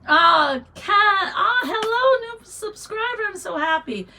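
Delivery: excited greeting. Real, not acted. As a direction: welcome her with delight. Greeting a new subscriber.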